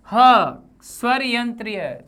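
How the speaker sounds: ह, strong breathy h; throat h demo. Shown as a demonstration. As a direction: push the ह out from the throat. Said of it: "गले से तेजी से".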